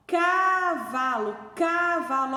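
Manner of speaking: "cavalo" with a long, high "ca"; slow caaa-valo to show the sound; first syllable stretched. Shown as a demonstration. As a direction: hold the "ca" of "cavalo". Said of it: "Olha o K aqui de novo".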